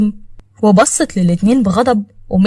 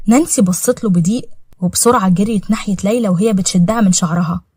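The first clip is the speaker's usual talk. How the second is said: plain reading voice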